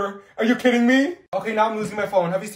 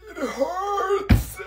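higher, crying "it hurts"; crying in pain; 10 semitones higher than usual. Acted. Olivia crying to the class that it hurts. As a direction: whimper it through the pain.

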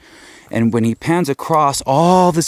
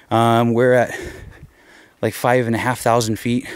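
normal talking voice

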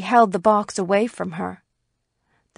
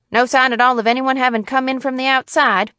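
businesslike attorney, raised slightly and louder; resuming conversation; 4 semitones higher, 5 dB louder, faster than usual. A narrator's reading. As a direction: pick the discussion back up briskly. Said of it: "in the manner of continuing an interrupted conversation".

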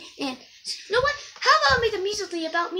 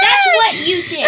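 laughing shout; 5 semitones higher, 11 dB louder than usual. Acted.

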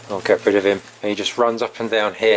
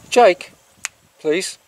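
a sharp, raised call of the dog's name; 7 semitones higher than usual. Real, not acted. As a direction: call him back across the yard. Calling the dog sharply.

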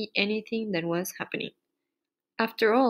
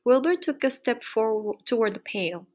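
usual speaking voice

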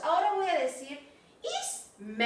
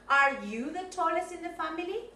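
ordinary delivery

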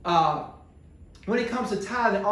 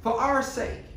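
preacher building to a climax for the congregation, soaring high; preaching climax; 7 semitones higher than usual. Acted. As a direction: lift it into a climactic preaching call.

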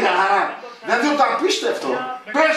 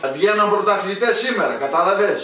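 ordinary delivery

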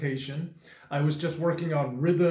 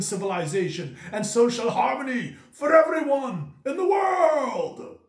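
epic monologue beast mode; 4 semitones higher than usual. Pretend. Inspiring parents and children worldwide, mock-epic monologue climax. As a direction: build grandly to a high, stretched climax. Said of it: "go into epic monologue beast mode".

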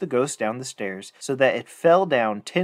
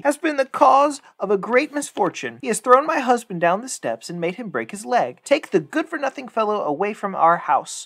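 high, raised complaining woman's voice; upset wife; 8 semitones higher than usual. Acted. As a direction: accuse the boy shrilly to his father.

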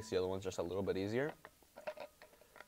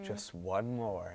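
weary, drawn out; much slower than usual. Retold or imitated. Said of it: "just because we're like".

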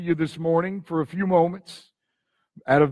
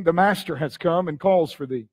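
ordinary talk, nothing marked

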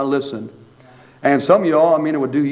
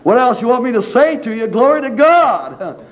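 exasperated husband; 8 semitones higher, 4 dB louder than usual. Retold or imitated. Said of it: "and say".

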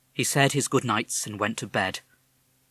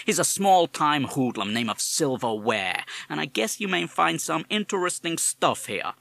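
put-on higher, louder parrot with a tough American accent; 5 semitones higher, 5 dB louder than usual. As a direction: talk like a fast-talking American wise guy. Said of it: "You have an American accent".

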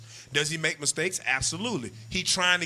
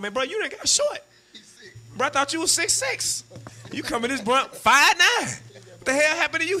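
teasing brother; 10 semitones higher, 3 dB louder than usual. Acted.

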